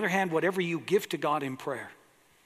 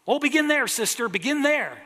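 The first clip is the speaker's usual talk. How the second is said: high, loud congregational shout; shouted back; 9 semitones higher, 6 dB louder than usual. Retold or imitated. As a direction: call out eagerly from the pews. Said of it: "they shouted back".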